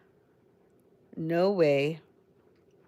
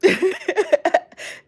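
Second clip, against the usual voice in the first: high, loud burst of laughter; real laugh; 9 dB louder than usual. Real, not acted. Streamer reacting to a viewer's comment, laughing hard.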